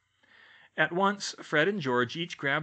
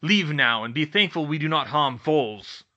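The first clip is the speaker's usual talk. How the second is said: loud, angry dismissal; roaring centaur; 2 semitones higher, 9 dB louder than usual. A narrator's reading. Bane banishes the young humans from his forest, roaring. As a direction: drive them out with forceful orders. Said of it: "Bane roared".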